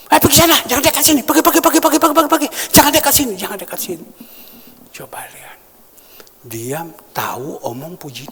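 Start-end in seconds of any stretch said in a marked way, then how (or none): 0.1-4.1 high, loud, fast "pergi, pergi"; contemptuous shooing. Retold or imitated. Man snaps at the garden cleaner to go away, high and loud. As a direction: shoo the lowly gardener away with scorn.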